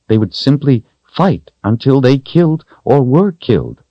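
earnest explaining in a lifted character voice; insistent woman character. Acted. Voices Kahlan in dialogue.

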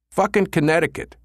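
high, scornful snap; a punk sneering about where she went; snapped. A narrator's reading. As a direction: spit the place name out with contempt. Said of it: "Two-Tone snapped".

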